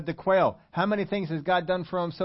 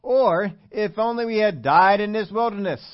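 loud, high, hammed-up lament; raised-voice mock protest of the congregation; mock complaining; 6 semitones higher, 7 dB louder than usual. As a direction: pile on the theatrical grievance. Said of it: "complained against Moses and Aaron".

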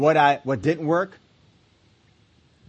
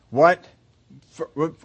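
ordinary delivery

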